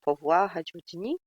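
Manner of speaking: quick, hammed-up French accent; bad French accent. Shown as a demonstration. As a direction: toss it off in a clumsy French accent. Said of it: "sorry for the bad French accent".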